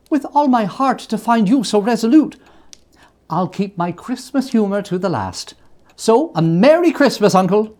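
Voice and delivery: high, loud good cheer; cheerful farewell. A narrator's reading. Nephew wishing his uncle a merry Christmas, high and bright. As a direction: stay merry despite him.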